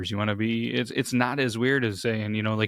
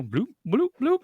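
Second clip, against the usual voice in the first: game sound noises; 13 semitones higher than usual. Retold or imitated. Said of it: "imitating game sound".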